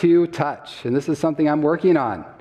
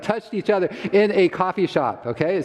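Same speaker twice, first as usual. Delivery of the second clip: ordinary talk, nothing marked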